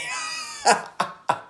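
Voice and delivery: squeaky high laugh; high giggle. Real, not acted. Laughing after hanging up, a high squeal.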